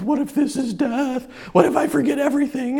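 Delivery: frightened inner voice spiraling; anxious fear. Retold or imitated. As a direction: fret it out, a little rushed. Retold panic about dying.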